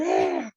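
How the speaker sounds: high, loud cry of disbelief; exaggerated high yell; mock scream. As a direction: let out a hammed-up wail. Acting out reaction to the line.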